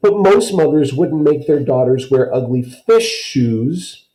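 put-on Kirsty grumbling to Ellen, high indignant child. A narrator's reading. Kirsty still bellowing.

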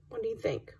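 ordinary delivery, nothing marked

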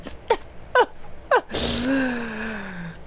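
wheezing laughing fit; soft laughter. Real, not acted. Gamer laughing at how stupid the game is, wheezing laugh. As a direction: laugh till it's almost tears. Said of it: "I'm laughing so hard".

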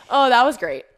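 ordinary talk, nothing marked

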